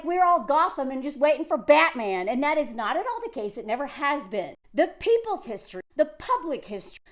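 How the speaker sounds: ordinary talk, nothing marked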